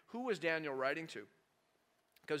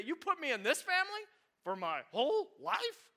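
much higher, put-upon whine; whining complaint; 13 semitones higher, 3 dB louder than usual. Retold or imitated. Believer grumbling to God about family, high mock whine. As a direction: complain as if life is unfair. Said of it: "You say".

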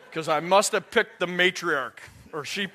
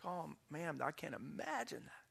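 very soft, tender acted condolence; hushed sympathy; 17 dB quieter than usual. Preacher replying to a widow, hushed sympathy. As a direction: drop right down in sympathy.

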